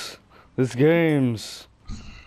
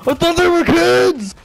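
high squeaky voice; 12 semitones higher, 5 dB louder than usual. Pretend. Repeated higher and louder.

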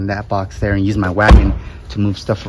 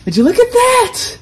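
excited; 4 dB louder than usual. Real, not acted. Proud of the fit.

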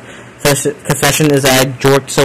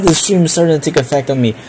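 ordinary talk, nothing marked

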